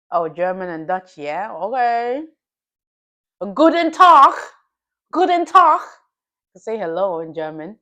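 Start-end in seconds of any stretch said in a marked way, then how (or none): 3.5-4.3 high, loud mock German greeting; mock German accent. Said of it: "They got strong accents though". 5.1-5.8 high, loud mock German greeting; mock German accent. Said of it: "They got strong accents though".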